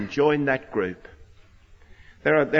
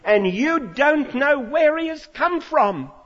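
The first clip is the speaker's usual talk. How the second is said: incredulous challenge; 11 semitones higher, 4 dB louder, slower than usual. Retold or imitated. Man mocking their ignorance.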